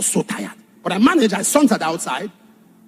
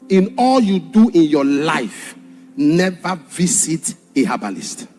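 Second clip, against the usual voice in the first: slow solemn warning; 5 semitones lower, slower than usual. Real, not acted.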